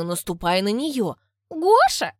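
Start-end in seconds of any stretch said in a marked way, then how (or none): 1.5-2.1 calling out. Acted. Neighbour calls him.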